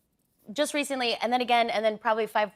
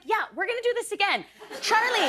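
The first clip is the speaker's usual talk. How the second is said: pointed mom; 9 semitones higher than usual. Retold or imitated. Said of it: "And I was like".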